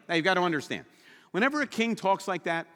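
normal talking voice

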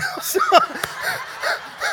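loud, high winded laughter; helpless high-pitched puffing laugh; breathless laugh. Real, not acted. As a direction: burst out laughing while out of breath.

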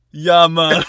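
loud mock Jamaican; Jamaican accent. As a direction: belt it out in hammed-up Jamaican. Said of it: "Jamaican and just like".

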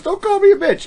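high-pitched imitation of a wife objecting; mock indignant wife, voice jumping well up; wife's protest. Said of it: "she goes".

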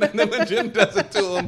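usual speaking voice

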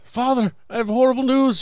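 high, mock tearful plea; hammed-up weeping widow; mock sobbing. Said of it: "She's sobbing".

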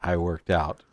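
low, quiet, gloomy mutter; brooding fake-deep mutter. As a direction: sulk it out, low and heavy. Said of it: "brooding low mutter".

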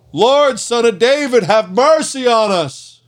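high, loud desperate cry, slowing on mercy. A narrator's reading. Blind men drowning out the crowd, high loud cry. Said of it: "they only shouted louder".

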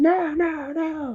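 high wail fading away; shrinking germ, high wail; germ's despairing cry. Acted. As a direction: wail as you lose.

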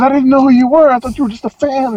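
high, gushing put-on apology; sheepish singer. Rock singer apologizing to his sound guy, high sheepish put-on voice. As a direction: backpedal fast, embarrassed.

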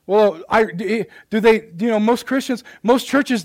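ordinary talk, nothing marked

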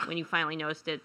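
normal talking voice

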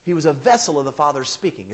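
ordinary talk, nothing marked

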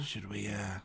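very soft, low aside; whisper. Pretend. Player privately checks with a party member, whispering. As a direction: ask it secretly, hushed and low. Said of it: "go up to Jose and whisper".

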